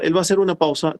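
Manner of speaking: ordinary talk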